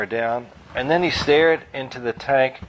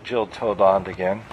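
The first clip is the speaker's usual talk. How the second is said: usual speaking voice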